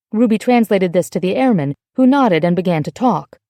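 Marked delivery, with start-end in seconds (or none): none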